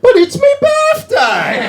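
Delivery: high, slow, shouted whine on my; whiny birthday shout. Retold or imitated. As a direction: wail it like a spoiled kid, way up high. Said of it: "And I said".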